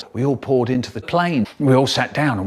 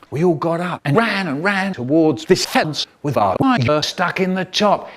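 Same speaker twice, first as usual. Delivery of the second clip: ordinary delivery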